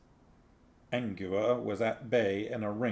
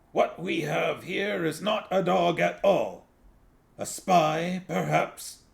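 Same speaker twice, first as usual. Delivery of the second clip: Big Fido voice; 4 semitones higher than usual. Acted. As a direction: make the poodle a paranoid zealot, slowing at the end. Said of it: "Big Fido's voice".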